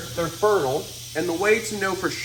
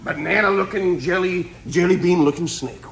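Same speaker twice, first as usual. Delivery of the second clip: ordinary talk, nothing marked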